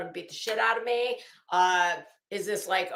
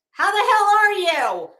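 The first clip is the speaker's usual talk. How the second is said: much higher and louder, slow and delighted; thrilled greeting; 11 semitones higher, 12 dB louder than usual. Real, not acted. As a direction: greet a long-lost friend with joy. Greeting an old friend.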